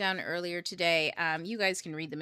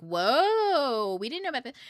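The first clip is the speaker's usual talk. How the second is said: amazed gasp; 7 semitones higher, 7 dB louder than usual. Retold or imitated. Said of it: "everyone's gonna be like".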